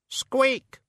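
very high rat squeak; tiny squeak. A narrator's reading. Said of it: "said the Death of Rats".

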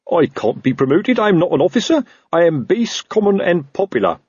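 protesting Sergeant Colon. Acted. Sergeant dreading promotion, high fretful protest.

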